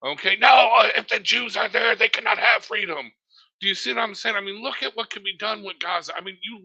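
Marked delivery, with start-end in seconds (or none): 0.4-3.1 loud mock refusal; mock blunt answer. Host voices critics answering about Palestinian freedom, loud and mocking. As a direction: bark it as their flat no. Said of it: "If you ask them".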